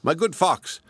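high and firm address; sit up straight and speak with resolve; determined voice. Acted. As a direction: magpie now standing up to the fox, determined. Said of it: "a bird with his head erect and a determined voice".

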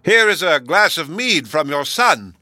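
slow, loud and emphatic, as to the deaf; shouting. Acted. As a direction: bellow each word slowly. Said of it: "cried the host as loud as he could".

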